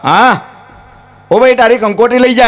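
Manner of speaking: high-pitched printer calling a customer; phone caller. Retold or imitated. Printer rings the groom's father about invitation cards, high phone voice. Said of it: "કે આમ phone આવ્યો".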